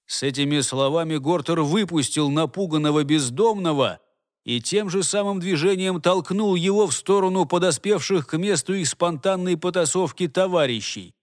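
plain reading voice

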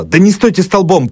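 high, loud urgent shout; sharp shout. A narrator's reading. Doctor shouting at servants during fire, loud urgent. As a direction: bark it at the helpers. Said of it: "прикрикнул".

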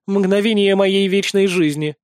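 usual speaking voice

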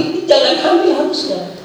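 a high, scolding mock doctor voice; mock angry doctor. Preacher imitating the doctor scolding the pregnant patient, high and mock-angry. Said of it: "marah-marah lagi".

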